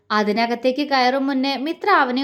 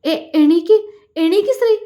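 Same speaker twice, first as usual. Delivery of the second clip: higher and louder frantic urging; stammering in panic; scared husband, voice jumping up; panicked; 5 semitones higher, 5 dB louder than usual. A narrator's reading. Husband panics as labour starts.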